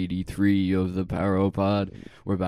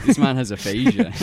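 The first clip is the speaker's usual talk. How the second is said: high, louder, through laughter; laughing; 11 semitones higher, 5 dB louder than usual. Real, not acted. Friend teasing the storyteller, laughing high and loud. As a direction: tease him while cracking up.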